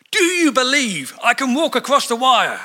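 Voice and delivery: high, loud showman call to the crowd; rally the crowd like a showman. Retold or imitated. Performer calls out to crowds at Niagara Falls, loud and high. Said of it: "and he said".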